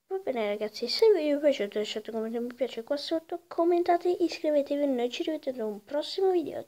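loud, fast outro sign-off; YouTuber outro. Acted. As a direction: hype up the sign-off. Energetic video outro.

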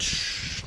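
a soft unvoiced shush; playful shush. Real, not acted. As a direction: hush the camera like a secret. Hushing after a joke.

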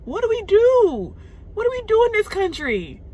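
high, pleading cry of helplessness; anguished plea. Real, not acted. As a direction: beg for an answer you know isn't coming. Desperate plea to viewers.

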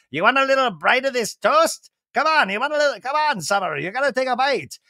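high playful coaxing; coaxing dad voice. Retold or imitated. Brock coaxing Summer to eat toast, high playful voice. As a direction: tease the toddler into a bite. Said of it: "he's like".

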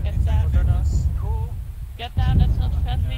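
loud warning shout; urgent shout. Real, not acted. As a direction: shout it to the squad. Artillery incoming, warning squad.

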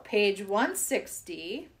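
ordinary delivery

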